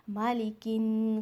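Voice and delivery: मालिकिन with a nasal, held न; slow nasal मालिकिन; ghunna. Shown as a demonstration. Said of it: "गुन्ना के साथ".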